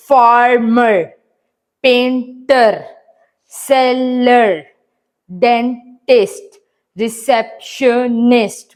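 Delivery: "फार्मर, पेंटर, सेलर, डेंटिस्ट, रिसेप्शनिस्ट" slowly, a gap between syllables; split into syllables. Shown as a demonstration. Teacher demonstrates syllabification to pupils, each word slowly split syllable by syllable. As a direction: break each word into its spoken parts, like "फार... मर". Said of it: "टुकड़ों में divide कर देते हैं".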